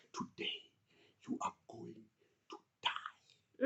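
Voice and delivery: hushed, breathy threat, barely voiced; menacing whisper. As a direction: whisper it like a death sentence. Brothers threaten Joseph in pit.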